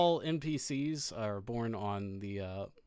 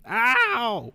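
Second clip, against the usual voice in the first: high yelp; 11 semitones higher, 8 dB louder than usual. Acted. NPC hurt.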